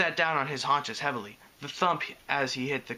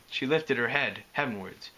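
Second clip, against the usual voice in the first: ordinary delivery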